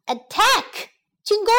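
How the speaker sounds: rally the troops; battle command. Acted. Queen ordering her bees to attack, bold call.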